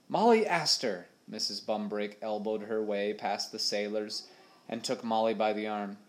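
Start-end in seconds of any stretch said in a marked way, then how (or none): 0.1-1.1 scolding call. A narrator's reading. Missus Bumbrake scolding.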